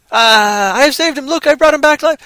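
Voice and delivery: high, loud proud rescuer voice; mock Jesus. Parodied Jesus boasting he saved him, high and loud. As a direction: ham up a proud savior showing off the body.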